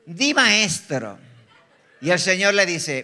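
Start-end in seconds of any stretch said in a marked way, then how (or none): 0.0-1.2 slow, drawn-out fawning; hammed-up flattering hypocrite; mock bootlicker. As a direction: lay on the fake reverence, stretching every word. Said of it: "El religioso es lambe ojo".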